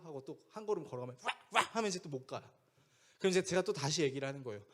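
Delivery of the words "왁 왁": short, sudden mock barks; imitated dog bark. Dog barking as the boy takes one step, imitated barking. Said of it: "막 짖는 거죠".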